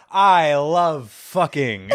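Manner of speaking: very drawn-out mock gloat; taunting. Friend taunting the aunt, hammed-up and drawn out. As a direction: stretch each word in an exaggerated taunt. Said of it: "taunting her".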